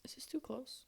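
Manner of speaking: soft whispered aside; whispering. Real, not acted. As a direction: whisper it close to the mic. Whispered question to co-host.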